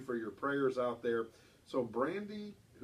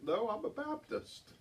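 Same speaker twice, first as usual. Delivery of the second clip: put-on higher, slow childlike punchline; child voice, relished; 9 semitones higher, slower than usual. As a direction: savor the child's line again. Host replays the punchline.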